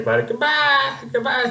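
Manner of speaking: very high squeaky mascot voice; Triple Threat. Acted. The buddy mascot wrapping up the video, high cartoonish goodbye. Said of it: "Triple Threat will say".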